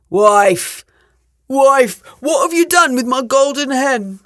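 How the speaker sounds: loud, high angry ogre shout; ogre calling out. A narrator's reading. Ogre missing his golden hen, loud accusing call. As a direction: bellow for the wife, accusing. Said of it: "he heard him calling".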